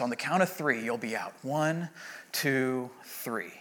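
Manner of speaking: low, very soft counting, fading; soft countdown. Retold or imitated. Anesthesiologist counting patient down to sleep, hushed countdown. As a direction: count someone gently under.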